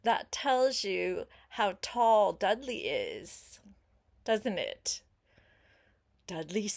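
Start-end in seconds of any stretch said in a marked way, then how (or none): none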